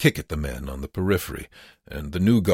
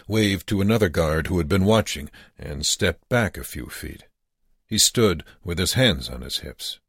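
plain reading voice

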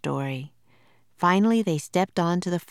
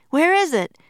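panicked; 11 semitones higher, 7 dB louder than usual. A narrator's reading. Panicked search for treehouse.

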